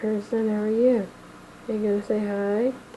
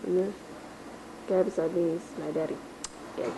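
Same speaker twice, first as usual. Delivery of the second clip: ordinary talk, nothing marked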